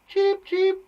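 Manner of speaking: squeaky bird-call cheeps; little birdie. Shown as a demonstration. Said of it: "like a little birdie".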